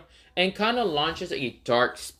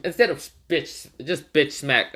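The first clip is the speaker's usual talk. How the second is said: usual speaking voice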